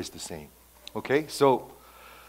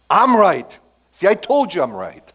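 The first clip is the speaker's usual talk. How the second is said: loud, higher, gloating; smug told-you-so; 7 semitones higher, 10 dB louder than usual. Retold or imitated. Preacher voicing Jonah gloating at God, smug. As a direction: rub it in like a know-it-all child. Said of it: "which means".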